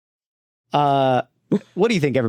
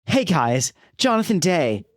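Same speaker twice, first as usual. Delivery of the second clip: bad child actor; 7 semitones higher than usual. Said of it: "Just a bad child actor".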